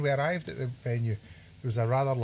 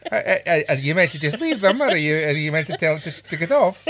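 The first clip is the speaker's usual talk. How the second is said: put-on louder Highland Scottish accent, laughing at the end; broad Highland voice asking, amused; 5 semitones higher, 9 dB louder than usual. Continues quoting manager in accent.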